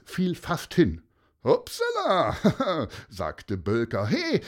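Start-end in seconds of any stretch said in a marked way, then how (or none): 1.4-3.1 high, drawn-out fake oops; mock-innocent oops. A narrator's reading. Man who shoved the boy feigns accident, mock-innocent. 4.1-4.4 high-pitched protesting shout; calling out. A narrator's reading. Boy protests at being shoved, calling out. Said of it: "rief Mattis".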